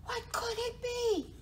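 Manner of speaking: high, wondering mouse voice; high-pitched storybook puzzlement; startled mouse. A narrator's reading. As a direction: ask it high, like a little mouse. Mouse wonders after squealing.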